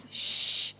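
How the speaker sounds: long, firm hushing noise; demonstrated shush. Shown as a demonstration. Moviegoer hushes people on their phone, firmer shush. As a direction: shush a chatterer in the next row. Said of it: "you do that?".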